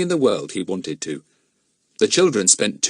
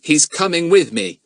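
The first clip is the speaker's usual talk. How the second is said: stern; 8 semitones higher, 5 dB louder than usual. Acted. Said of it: "said Philip sternly".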